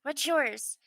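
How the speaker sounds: put-on high, loud eager child; little boy voice. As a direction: blurt it like an excited kid. Little boy introduces himself.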